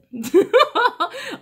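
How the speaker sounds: a sudden high squeal of real laughter; high burst of laughter. Real, not acted. Reader bursting into laughter at the cards, high squealing laugh. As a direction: laugh out loud, high and bright.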